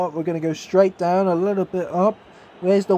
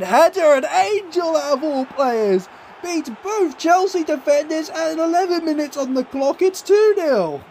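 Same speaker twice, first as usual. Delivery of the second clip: excited goal call; 7 semitones higher than usual. Real, not acted. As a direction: sell the goal like live TV.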